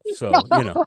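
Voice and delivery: high, laughing through the words; real laughter, voice jumping up. Real, not acted. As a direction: break into a laugh while talking. Laughs at double-dipping joke.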